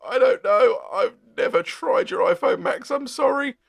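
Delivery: embarrassed, high-pitched retold excuse; flustered apology. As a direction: caught out, say sorry awkwardly. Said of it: "And I was like".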